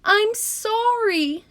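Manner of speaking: high, slow, wailing apology; child crying sorry. Acted. Little girl wailing her apology to her mother, high and slow. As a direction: wail it like a small child caught out. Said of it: "Harriet cried".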